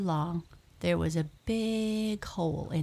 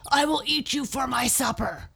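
loud, fast, raised lion voice; angry roar; 8 semitones higher, 5 dB louder, faster than usual. A narrator's reading. Lion threatening the mouse he grabbed, roaring angrily. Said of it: "Lion roared angrily".

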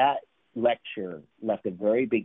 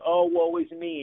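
high, hammed-up wail of self-pity; overdramatic, depressed; 7 semitones higher than usual. Mimicking someone who claims a terrible day, overdramatic. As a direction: play up the misery, mockingly. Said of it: "somebody that's over dramatic and overly depressed".

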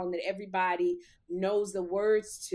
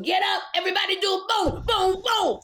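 high, fast crowd-rousing shout; belted club hype call; hype-man shout; 11 semitones higher, 5 dB louder than usual. Retold or imitated. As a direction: shout like a party MC working the room. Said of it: "You hear Marco going".

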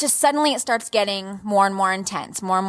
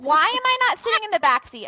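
high incredulous; 12 semitones higher, faster than usual. Retold or imitated. Self-mocking high retelling.

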